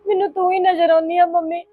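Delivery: high, slowing, sobbing cries for a lost mother; crying for mother. Acted. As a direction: weep out the words, voice breaking. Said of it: "ਨਾ ਰੋ".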